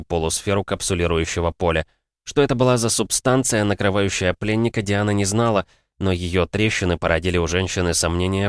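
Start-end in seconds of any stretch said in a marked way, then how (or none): none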